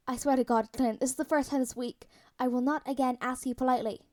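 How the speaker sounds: ordinary delivery, nothing marked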